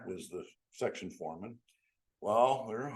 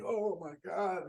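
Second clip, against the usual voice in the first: excited greeting; 9 semitones higher, slower than usual. Retold or imitated. Old railroad buddies greeting him.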